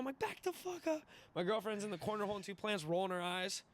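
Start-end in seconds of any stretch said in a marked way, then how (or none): 0.2-1.0 high-pitched mock frantic yell; retold freak-out. Drunk man to people asking what's going on, mock freak-out. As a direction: order the crowd back in a high, panicky imitation. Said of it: "I'm freaking out".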